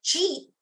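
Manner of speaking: "ji" as one quick syllable; New York fast speech. Shown as a demonstration. Lecturer stressing dialect "ji" is one syllable, clipped. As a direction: clip "ji" into a single beat. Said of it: "is not three syllables, it's one syllable".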